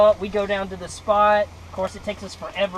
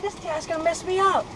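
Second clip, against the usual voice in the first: high-pitched mock complaint; acted dismay; 8 semitones higher than usual. Boy worried about losing his catch, high mock dismay. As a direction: imitate a put-out kid, voice held high.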